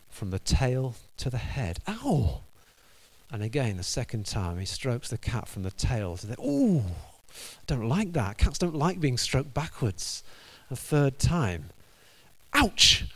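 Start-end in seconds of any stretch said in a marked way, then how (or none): 1.9-2.4 mock yelp. Cat stroked backwards. 6.4-8.4 high squeaky ooh of a put-out cat; mock cat complaint. Cat complains as God strokes it backwards. 12.5-13.0 high, loud yelped ouch; mock ouch. Cat yelps as God strokes it backwards, high and loud.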